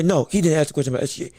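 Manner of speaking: ordinary talk, nothing marked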